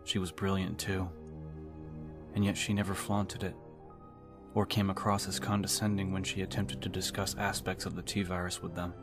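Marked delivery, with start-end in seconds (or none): none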